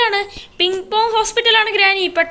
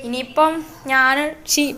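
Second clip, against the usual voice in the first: ordinary delivery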